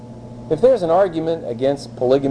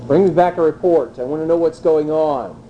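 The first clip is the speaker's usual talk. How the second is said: usual speaking voice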